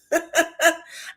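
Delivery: high imitated cackling laugh; acted laughter. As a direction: burst into Tisha's giggles, mimicking. Imitating Tisha laughing at the joke.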